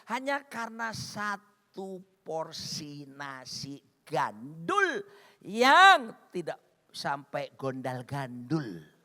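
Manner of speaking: very slow, word by word; drawn-out emphasis. Real, not acted. Preacher marvels at long trip for rice, drawn-out emphasis. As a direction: stretch out each word for comic weight. Mock amazement at trip for food.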